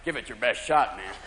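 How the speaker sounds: low, unruffled cat voice; cool cat. Acted. Cat daring stoned owner, low cool voice. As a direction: drop it low, daring them. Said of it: "your cat because he's looking at you like".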